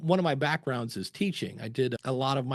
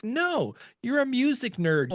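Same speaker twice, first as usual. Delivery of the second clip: much higher, playful accusation; teasing friend; 10 semitones higher, slower than usual. Retold or imitated. Friend at dinner calling him a music nerd, teasing. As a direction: tease him for being a nerd.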